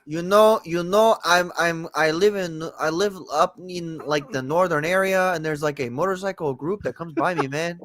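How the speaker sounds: lilting mock Mexican-American accent. Shown as a demonstration.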